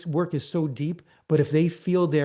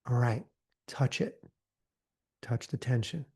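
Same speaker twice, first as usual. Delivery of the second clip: much softer, hushed guiding; soft guidance; 3 semitones lower, 6 dB quieter than usual. Shown as a demonstration. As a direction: gently guide them to the tension. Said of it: "guiding breath work".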